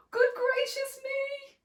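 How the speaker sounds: sharp gasp, then high-pitched astonishment; shocked exclamation. A narrator's reading. Mother sees the water, gasping exclamation. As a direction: gasp and squeal in storybook shock. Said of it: "looked into the water and exclaimed".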